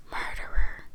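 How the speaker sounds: eerie, voiceless whisper. A narrator's reading. Ghostly voice accusing the old man in the garden, whispered. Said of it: "a voice whispered".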